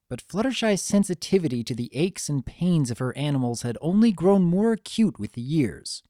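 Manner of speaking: usual speaking voice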